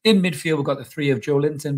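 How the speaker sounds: ordinary delivery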